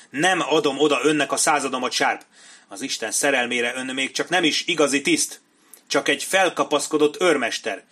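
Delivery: loud, indignant dressing-down; angry refusal. Acted. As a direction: berate an upstart subordinate before the company. Captain berates Sharp publicly. (felháborodott, hangos kioktatás)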